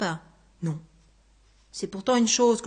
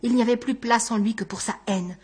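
plain reading voice

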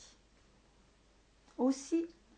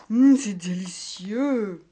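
slow, louder savouring ogre voice; delighted ogre; 7 dB louder, slower than usual. Acted. Ogre tasting a book, slow and delighted. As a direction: relish every syllable.

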